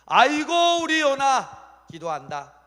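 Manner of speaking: high, loud, drawn-out exclamation of delight; hammed-up joyful marveling; overjoyed God. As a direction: gush proudly over your child. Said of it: "하나님이 기뻐하시는 거예요".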